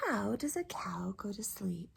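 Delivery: put-on whispering to sleepy children. Shown as a demonstration. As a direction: hush it like a bedtime secret. Repeated question to children whispered.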